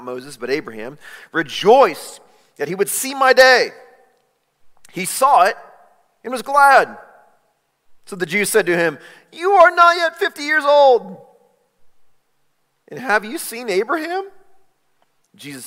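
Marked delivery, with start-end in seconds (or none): 1.3-7.0 high, loud, joyful telling; preacher voicing Jesus with joy; excited quote. 9.3-14.3 high, loud scornful voice; the religious leaders taunting Jesus; mocking challenge.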